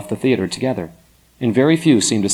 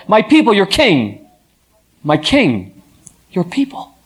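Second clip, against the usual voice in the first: high, loud proclamation; proclaiming; 8 semitones higher, 4 dB louder, slower than usual. A narrator's reading. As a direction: announce the king to everyone. Thespia proclaims the king.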